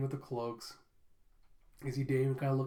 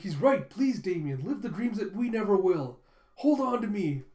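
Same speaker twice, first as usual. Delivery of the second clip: melodramatic plea; 5 semitones higher, 7 dB louder than usual. Acted. Dramatic sacrifice speech.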